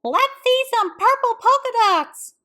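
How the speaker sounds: put-on high, loud kid's shout; a kid yelling across the classroom; yelled out. A narrator's reading. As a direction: shout it like a teasing schoolkid. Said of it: "One yelled out".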